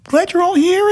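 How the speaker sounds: very high squeaky puppet greeting; chirpy little monster welcoming everyone; Elmo voice. Retold or imitated. Teller voicing Elmo welcoming everyone, very high and squeaky. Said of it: "I can't do Elmo".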